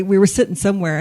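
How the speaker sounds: usual speaking voice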